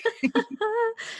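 high bright laugh. Real, not acted. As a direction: laugh at your own joke. Laughs at own joke.

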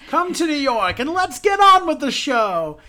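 big, high, loud showman's call; Nathan Lane impression. Host mimicking the star's New York tourism commercial, loud showman voice. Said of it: "it's the iconic Nathan Lane's voice".

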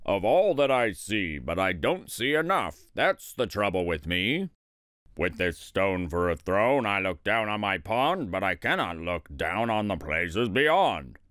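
low, discontented turtle king; frowning turtle king. A narrator's reading. Yertle complains his throne is too low, frowning. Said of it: "he said with a frown".

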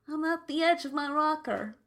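put-on a light fed-up old-timer; old person voice. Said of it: "I sound so old when I said that".

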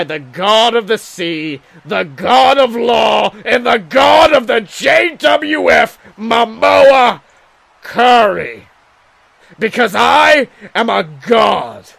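shouting megalomaniac boast; megalomaniac rant. Acted. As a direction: roar it like a god. Promo climax, boasting.

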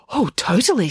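gushing agreement, pitch swooping up; excited. A narrator's reading. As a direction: agree with lively enthusiasm. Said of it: "said Loretta excitedly".

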